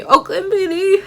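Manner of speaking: high, loud mock wail; retold crying. Daughter correcting herself on the phone to dad, mock crying. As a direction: blubber it in an exaggerated whine. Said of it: "I'm crying so hard".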